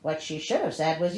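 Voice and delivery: ordinary talk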